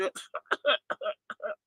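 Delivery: breathless unvoiced laugh, falling away; a laugh that slips out mid-story; wheezing laugh. Real, not acted. Laughs while quoting wino.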